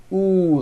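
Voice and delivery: ooh with lips pushed forward; rounded ooh. Shown as a demonstration. Teacher showing the lip position for W. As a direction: push the mouth forward on ooh. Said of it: "first say ooh with your whole mouth forward".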